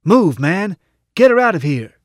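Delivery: high, loud yelled order; urgent yell. A narrator's reading. Shorty pushing his companion to escape, urgent high yell. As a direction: yell to get them moving now. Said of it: "Shorty pushed me and yelled".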